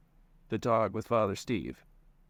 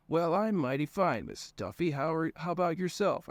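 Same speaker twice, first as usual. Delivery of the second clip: higher, swooping mock politeness; mock self-answer; 7 semitones higher than usual. A narrator's reading. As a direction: answer your own greeting in mockery. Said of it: "I answered myself".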